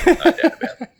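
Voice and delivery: excited. Real, not acted. Guest jokes about Aussie accent.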